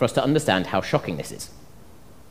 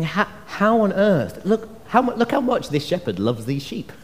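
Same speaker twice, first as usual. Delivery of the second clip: amazed onlooker; 9 semitones higher than usual. Acted. Another shepherd watching the sacrifice, high-pitched and amazed.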